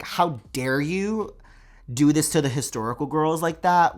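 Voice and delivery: ordinary talk, nothing marked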